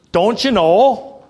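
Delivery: lightly put-on Canadian "Don't you know"; a hint of Canadian folksiness on "Don't you know"; Canadian accent. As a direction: drawl "Don't you know" in a mock Canadian lilt, slow. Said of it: "That's for our Southern Canadian guests".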